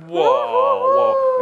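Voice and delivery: high, loud excited whoa; amazed whoa. Acted. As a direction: gasp at it like a marvel. Mock amazement at flash drive.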